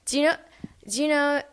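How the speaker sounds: high, playful attempt at a British accent. Shown as a demonstration. Guest showing the hosts her accent, silly posh British. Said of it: "I don't even know what accent that was".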